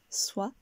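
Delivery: SOIT with the voice going up; rising tune. Shown as a demonstration. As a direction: lift the pitch on soit. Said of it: "le son monte".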